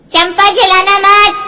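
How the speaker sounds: slow, loud call from another room. Acted. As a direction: call out to the maid across the house. Aditi calls from another room. (दूसरे कमरे से ज़ोर से पुकारना)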